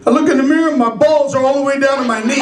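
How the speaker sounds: usual speaking voice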